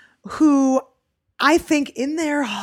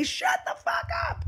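mock yell; 9 dB quieter than usual. Comic replays the heckler disrupting the show, high suppressed mock yell. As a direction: squeeze out a heckler's holler without real loudness. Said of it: "And he yells".

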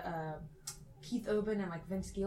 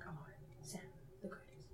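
excited whisper, much softer; imitated whisper; 5 semitones lower, 18 dB quieter, faster than usual. Mom spotting [name] in the store, excited whisper. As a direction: hush it like a secret to her son. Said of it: "Don't whisper".

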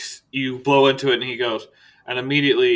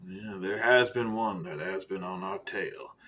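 low, soft, measured director voice with long pauses; Director Silas, understated menace; 4 semitones lower, 8 dB quieter, slower than usual. Acted. Director turns to business with Archibald, quiet and measured. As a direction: speak quietly and let each phrase hang.

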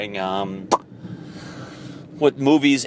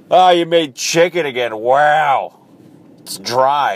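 higher, louder, sneering put-on voice; mocking ex-girlfriend; 5 semitones higher, 7 dB louder than usual. Ex-girlfriend to boyfriend, loud sneering mockery. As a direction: sneer at the dinner as the ungrateful ex.

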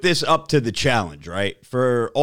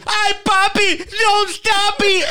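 high, drawn-out mock scream; screamed catchphrase; 4 dB louder than usual. Prankster on camera embarrassing his cameraman, high scream. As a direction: shriek it to embarrass your friend. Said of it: "I was gonna fucking scream some shit".

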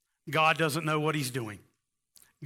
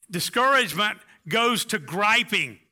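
ordinary delivery

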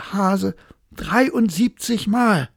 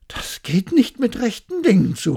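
astonished cry; 5 semitones higher, 5 dB louder than usual. Acted. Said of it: "rief er".

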